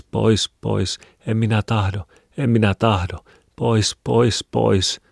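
high, pleading whine; distressed high-pitched protest; whimpering. A narrator's reading. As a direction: whimper to be let away. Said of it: "hän uikutti".

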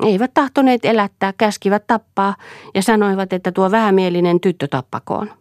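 put-on a strange girl recalling cruelty with relish; simple-minded servant girl. A narrator's reading. Silja voiced by narrator. (korkeampi tytön ääni, oudon innostunut)

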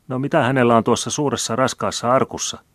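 saucy. A narrator's reading. Maid asks the old man about his heavy chest, saucy. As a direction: ask it loud and nosy, with cheek. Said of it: "kysyi Piika nenäkkäällä äänellä".

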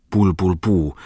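bubbling noises from a washbasin; gurgling underwater. Acted. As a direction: gurgle with head in water. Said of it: "koitti päästä ääniä pesuvadista". (kurlutus vedessä)

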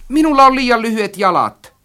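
very high, loud protest. A narrator's reading. Narrator pleads short legs to friend, protesting. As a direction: make the excuse at full pitch. Acted quarrel, excuse not to climb.

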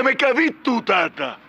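high, aggrieved yell re-enacted; retold shout. Caller re-enacting how he threw the drinkers out, retold shout. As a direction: act out yelling them out of the room. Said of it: "Huusin, että".

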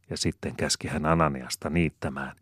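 ordinary delivery

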